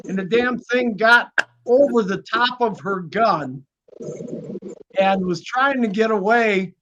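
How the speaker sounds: ordinary delivery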